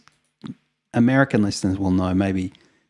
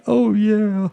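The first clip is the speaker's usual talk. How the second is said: high, wailing "Oh, yeah" pitched way up; mock sobbing; 11 semitones higher than usual. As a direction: imitate a jug crying over a lost friend. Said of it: "'cause he's crying about his friend".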